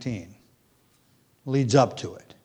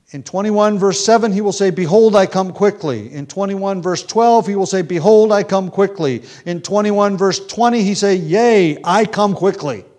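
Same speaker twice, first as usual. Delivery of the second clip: louder, higher fervent recitation; rising sermon fervor, louder and higher; fervent preaching; 6 semitones higher, 7 dB louder than usual. Acted. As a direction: build the fire of the pulpit. Rising fervor quoting Revelation.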